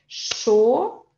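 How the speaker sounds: "cho" as one lone, stretched syllable; sílaba isolada. Shown as a demonstration. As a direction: hold "cho" out on its own. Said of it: "aqui é uma sílaba". ("cho" como sílaba isolada e alongada)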